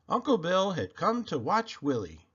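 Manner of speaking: ordinary delivery, nothing marked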